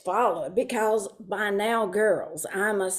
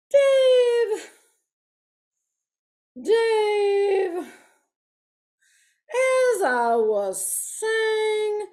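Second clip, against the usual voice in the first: high, strained cry for Dave; frazzled wife crying out to stop her husband; pleading; 9 semitones higher, 3 dB louder, much slower than usual. Retold or imitated. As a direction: plead high and slow, near tears.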